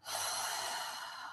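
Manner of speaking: long, soft exhale; audible centering sigh. Shown as a demonstration. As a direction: let a slow breath all the way out. Demonstrates a centering breath.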